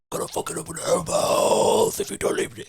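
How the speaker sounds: high, harsh death metal screech; death metal scream. Acted. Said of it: "I scream in a sort of, like, um, like a death metal".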